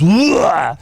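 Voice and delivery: sudden loud mock heave; imitated retching. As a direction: imitate food bursting back up. Imitates food coming back up.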